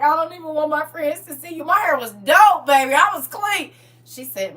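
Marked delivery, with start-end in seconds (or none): 0.0-1.6 high-pitched mock distress of a child; imitating embarrassed daughter. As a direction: play a mortified kid disowning mom. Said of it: "She was so embarrassed".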